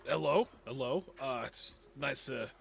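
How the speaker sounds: a hint of a craftsman caught off guard, apologizing; flustered blacksmith.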